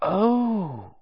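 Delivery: high surprised cry; sudden realisation. A narrator's reading. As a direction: gasp as it dawns on her.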